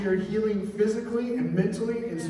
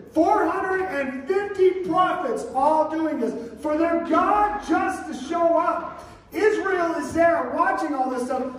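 rising, louder preacher's build; fired-up sermon storytelling; preaching at full force; 11 semitones higher, 5 dB louder than usual. Acted. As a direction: preach it big to the room.

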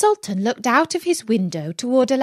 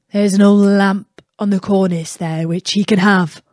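palace slave; 5 dB louder than usual. Acted. Palace slave speaks to princess.

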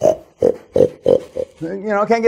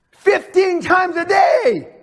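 incredulous shout; 7 semitones higher, much slower than usual. Real, not acted. Ranting to a seminar crowd, disbelieving shout. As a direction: spell out the number in outrage.

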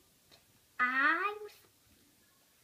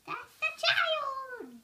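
much higher, slow put-on squeak; squeaky pretend voice; 9 dB louder, slower than usual. Child playing the child in her story, high squeak. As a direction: squeak it out like a tiny character.

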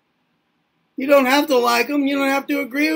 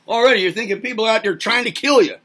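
ordinary delivery

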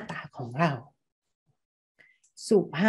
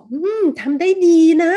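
sincere praise; 9 semitones higher, 10 dB louder than usual. Shown as a demonstration. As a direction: praise the work like you truly mean it. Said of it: "รู้สึกดีใช่ไหมคะ".

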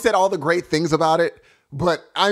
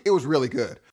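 unimpressed; 6 semitones lower, faster than usual. Retold or imitated. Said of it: "you were just like".

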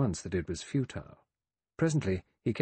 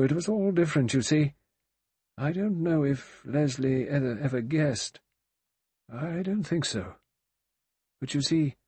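higher, faltering, a little distressed; puzzled, distressed; 7 semitones higher, 4 dB louder than usual. A narrator's reading. Old general confessing to Vera he doesn't know, puzzled and distressed. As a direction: search for words in puzzled distress. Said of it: "looked puzzled and a little distressed".

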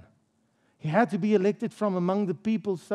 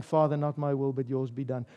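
much softer and lower, yielding; a quiet, broken yielding to the Father; hushed surrender; 9 semitones lower, 8 dB quieter than usual. Acted. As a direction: breathe the prayer out in surrender. Jesus' final prayer, enacted.

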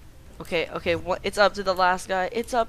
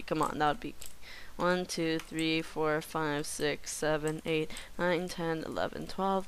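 ordinary talk

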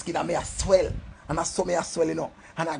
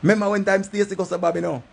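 loud heated recall; 6 semitones higher, 5 dB louder than usual. Acted. Repeated line, first take.

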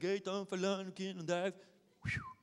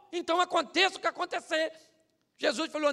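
normal talking voice

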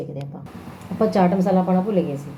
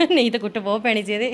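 laughing; 5 semitones higher, 4 dB louder than usual. Real, not acted. Laughing about beating threat.

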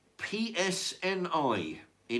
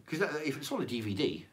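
normal talking voice

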